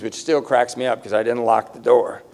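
usual speaking voice

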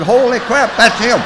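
high, loud burst of relief; excited realization. Acted. Owner learns the lost dog is found, excited outburst. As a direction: exclaim it, pitch leaping up.

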